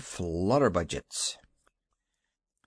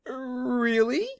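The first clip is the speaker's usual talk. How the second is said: hopeful surprise; 12 semitones higher than usual. A narrator's reading. Woman brightening, hopeful.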